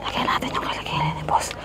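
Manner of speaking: hushed whisper beside sleeper; breathy whisper, don't wake him. Real, not acted. Sneaking up on sleeping boss.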